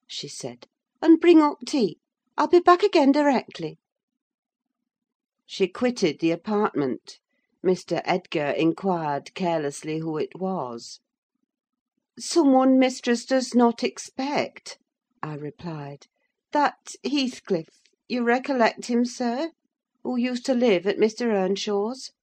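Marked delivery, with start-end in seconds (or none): 1.0-3.8 high, louder mistress voice; voiced lady of the house. A narrator's reading. Mistress tells her servant to close up, high and commanding. 12.2-14.8 higher-pitched servant's reply; voiced servant. A narrator's reading. Servant answers the master about a visitor, higher voice. 16.5-22.1 lightly voiced higher housekeeper; voiced servant. A narrator's reading. Servant reminds master who Heathcliff is, higher questioning voice.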